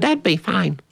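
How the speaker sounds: put-on high, childlike little boy's reply; little brother voice. Little brother agreeing to a haircut, high childlike voice. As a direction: play the small brother agreeing sweetly.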